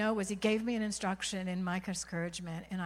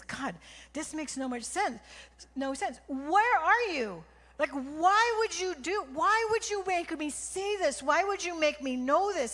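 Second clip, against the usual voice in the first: anguished plea; 7 semitones higher, 4 dB louder than usual. Retold or imitated. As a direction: plead with God in despair, voice strained high. Retold desperate prayer to God.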